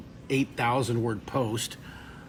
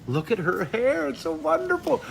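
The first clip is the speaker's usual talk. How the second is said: much higher, gushing over it; cooing admiration; 11 semitones higher than usual. Real, not acted. Admiring a viewer's picture.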